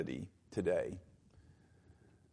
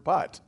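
"but" pitched up and punched as a single word; emphasis on "but"; 5 semitones higher than usual. Shown as a demonstration. Preacher singles out a key word for the congregation, emphatically. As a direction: hit "but" hard, higher than the talk around it. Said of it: "I'm gonna emphasize the word".

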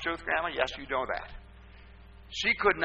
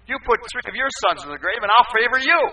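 higher, louder comeback to a critic; sharp retort; 8 semitones higher, 9 dB louder, faster than usual. Retold or imitated. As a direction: fire it back at her, hard. Retold retort to complaining woman.

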